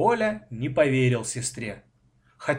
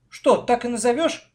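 high, louder question of surprise; astonished, raised-pitch query; surprised; 10 semitones higher, 5 dB louder than usual. A narrator's reading. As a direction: check in disbelief. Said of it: "удивился я".

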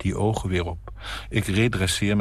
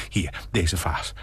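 slow whisper; whispered aside; slower than usual. Acted. Nina murmuring while choosing a vase, whispered. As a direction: murmur it to yourself.

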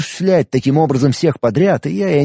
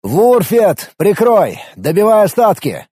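higher, louder shouted orders; battle shout; 6 semitones higher, 3 dB louder, slower than usual. Acted. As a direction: call out over the fight. Calling orders to ally in battle.

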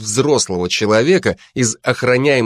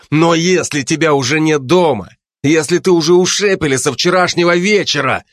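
growing fury; 6 semitones higher than usual. A narrator's reading. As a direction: start losing your temper. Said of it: "начал свирепеть Майкл". (нарастающая ярость)

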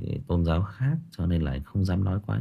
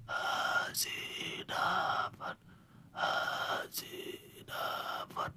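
breathless whispered chant, broken up; dying man forcing out a whispered chant; dying man's breath; 17 dB quieter, much slower than usual. Retold or imitated. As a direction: gasp the words out with no voice left. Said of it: "Mà thì đọc hết mắt trố lên".

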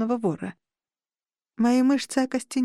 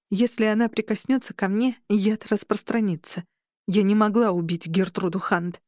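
usual speaking voice

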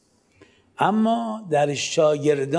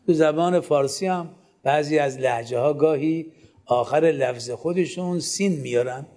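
ordinary talk

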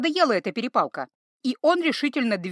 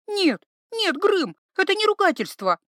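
much higher, urgent pleading; pleading girl; 10 semitones higher, 3 dB louder than usual. Acted. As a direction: beg him desperately. Iva pleading.

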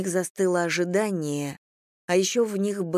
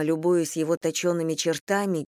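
usual speaking voice

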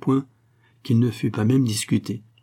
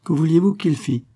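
lightly naive, high-pitched question; a touch of artless wonder, higher; 7 semitones higher, 4 dB louder than usual. A narrator's reading. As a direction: ask it guilelessly, pitch lifted. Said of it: "dit naïvement Marie".

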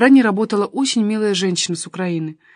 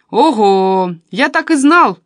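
excited shout; 3 semitones higher, 8 dB louder, slower than usual. A narrator's reading. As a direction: burst in with a triumphant yell. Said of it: "раздался совсем рядом голос Веньки".

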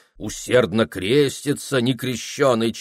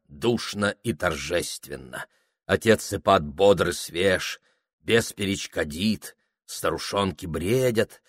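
plain reading voice

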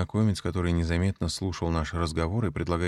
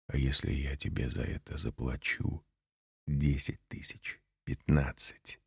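speaking quietly; 4 semitones lower, 6 dB quieter than usual. Acted. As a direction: keep it quiet so no one overhears. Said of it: "я говорил так тихо".

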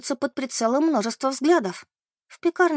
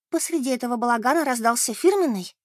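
ordinary delivery, nothing marked

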